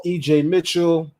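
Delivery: ordinary talk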